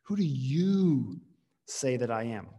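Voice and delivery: slow, with "you" stretched and a pause after it; emphatic YOU. Acted. Jesus putting the question to his disciples, emphatic YOU. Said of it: "the you is really emphatic here".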